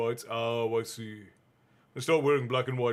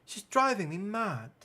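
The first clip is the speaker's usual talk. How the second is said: put-on higher, fed-up teenage girl; exasperated Ginny; 7 semitones higher than usual. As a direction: whine it out, lightly. Ginny complaining.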